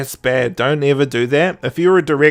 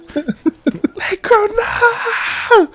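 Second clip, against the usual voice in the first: high, hammed-up sobbing plea; mock wailing grief; much slower than usual. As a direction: wail the name like a melodrama death scene. Mock grief over friend's joking threat.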